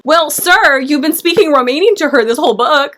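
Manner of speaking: playfully affronted confrontation; indignant telling-off. Pretend. Mock scolding of the book's Dracula.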